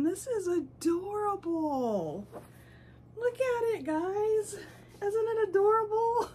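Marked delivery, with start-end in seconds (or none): 3.2-6.3 high-pitched, delighted coo; high gushing, giggle at the end; doting coo. Real, not acted. Showing off adorable doll to viewers.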